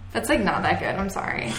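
normal talking voice

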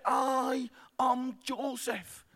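put-on very slow, drawn-out little-boy line; timid child actor. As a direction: drag out each word like a stiff nervous kid. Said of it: "And he's there".

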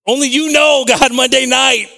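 high, loud testimony to God; impassioned, high-pitched outburst; fervent preaching. Real, not acted. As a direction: raise it to a preacher's cry. Preaches at full force.

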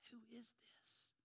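slow whispered question; awed whisper. Acted. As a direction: whisper it in hushed wonder. Disciples awed by Jesus' power.

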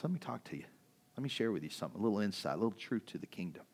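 soft, patient Jesus voice; gentle teaching. Retold or imitated. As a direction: share the truth softly like a kind teacher.

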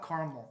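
"caramel" said as CAR-mul; "caramel" with a CAR start, for show; pretentious pronunciation. Shown as a demonstration. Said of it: "if I'm gonna be pretentious".

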